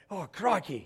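hammed-up Australian exclamation; Australian accent. Shown as a demonstration. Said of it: "that's my Australian accent".

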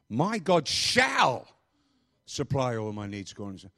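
slow, hammered SHALL; stress on SHALL. Shown as a demonstration. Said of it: "emphasizing one word at a time".

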